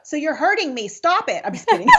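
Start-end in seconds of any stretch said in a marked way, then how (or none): 1.4-1.9 chuckling. Real, not acted. Teasing after mock complaint.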